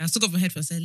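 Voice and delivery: ordinary talk, nothing marked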